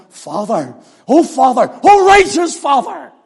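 building from a plea to a loud, high cry; an anguished prayer swelling to a cry; desperate cry. Shown as a demonstration. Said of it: "that desperate, deep cry".

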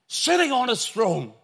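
high, loud, emphatic scripture cry; excited proclamation. Shown as a demonstration. Preacher reads Isaiah's vision of the throne, loudly excited. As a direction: ring it out in excitement. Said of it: "there's an excitement about him".

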